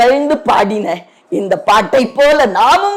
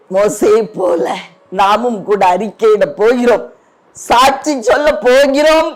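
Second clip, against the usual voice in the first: usual speaking voice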